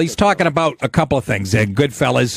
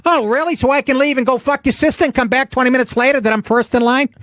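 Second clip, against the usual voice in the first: higher mock mobster sneer; Christopher; 10 semitones higher than usual. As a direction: needle the kid like a wiseguy. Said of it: "the kid goes".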